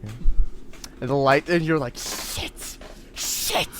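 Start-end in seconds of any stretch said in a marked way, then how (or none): 2.0-3.7 sharp whispered cursing; whispered panic. Acted. Kid caught coming home by mom, panicked whisper. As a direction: curse under your breath, caught out.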